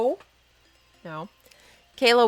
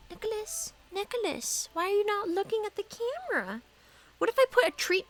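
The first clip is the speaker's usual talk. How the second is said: high, coaxing voice to a dog; pet talk; 9 semitones higher, 5 dB quieter than usual. Real, not acted. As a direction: sweet-talk the dog into looking up. Coaxing her dog to look.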